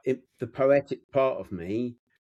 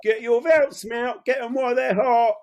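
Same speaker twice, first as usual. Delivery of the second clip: street hawker. Pretend. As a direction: hawk it like a market seller. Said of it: "I'm seeing an advertising".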